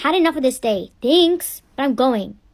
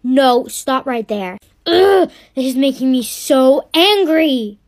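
louder, slow and exasperated; annoyed outburst; 5 dB louder, slower than usual. Acted. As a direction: tell them to stop, fed up. Sister angered by ice cream.

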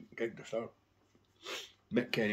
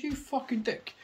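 much higher, snapping insult; retold insult; slower than usual. Fan retelling insulting the guitarist, high mock snap. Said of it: "I goes".

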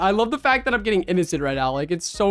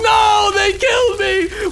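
high, loud, drawn-out cry of protest; dismayed shout; 11 semitones higher, 5 dB louder, much slower than usual. Real, not acted. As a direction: yell it in outraged disbelief. Just got killed in game.

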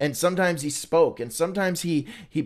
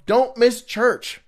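higher, louder and slow, stretching don't miss church; emphatic warning; 8 semitones higher, 6 dB louder, slower than usual. Real, not acted. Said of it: "Side note".

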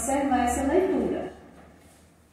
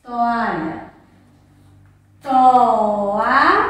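"Toalha" said twice, the second louder with a rise; repeated model word; 8 dB louder, much slower than usual. Shown as a demonstration. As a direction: repeat "Toalha" clearly for children. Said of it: "pronuncie a palavra".

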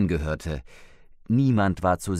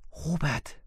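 breathless, higher, softer cry of a name; gasping in fright; 8 semitones higher, 5 dB quieter than usual. A narrator's reading. Woman finds a body, gasps to husband. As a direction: gasp his name, scared. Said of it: "keuchte Elfriede".

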